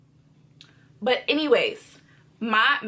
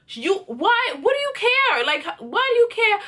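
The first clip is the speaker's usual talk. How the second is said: high-pitched exasperated questioning; indignant challenge; 7 semitones higher than usual. Retold or imitated. Girlfriend arguing over friend's car, exasperated. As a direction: act out disbelief at his meddling.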